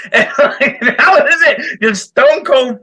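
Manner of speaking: laughing. Real, not acted. Laughing while retelling.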